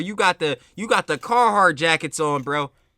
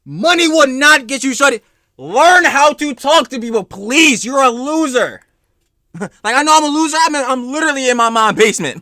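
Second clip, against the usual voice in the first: loud, raised-pitch outburst; exasperated ranting; 6 semitones higher, 7 dB louder than usual. Real, not acted. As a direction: rant loudly in sheer exasperation. Exasperated rant at the desperate spender.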